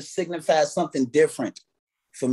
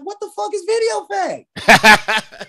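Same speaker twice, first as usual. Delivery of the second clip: much higher, slow, laughing disbelief; baffled; 7 dB louder, slower than usual. Retold or imitated. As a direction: stretch it out in mock shock. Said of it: "I'm like".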